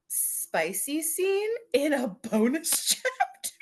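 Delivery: high, slow, giggling hopeful question; giggly question. Retold or imitated. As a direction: let a laugh slip through it. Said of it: "I kept being like".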